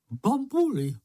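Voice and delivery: high, squeaky little character voice; very high cartoon creature answer; giggly creature. A narrator's reading. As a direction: pipe it out high, with a giggle behind it. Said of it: "odpověděl čenich a zahehňal se".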